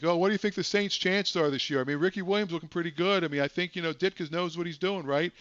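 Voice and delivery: mock earnest fan calling in; a lightly voiced caller talking Saints football. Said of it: "he'd be talking serious".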